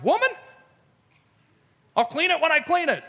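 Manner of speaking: high, playful backtalk to mom; kiddish sass. Retold or imitated. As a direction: sass your mother in a high kid's whine. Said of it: "in this very kiddish way".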